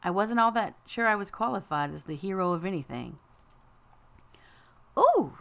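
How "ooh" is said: high, loud delighted squeal; happy ooh. A narrator's reading. Girl spotting carrot cake, high happy ooh. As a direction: squeal with childish glee. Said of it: "said Ashley happily".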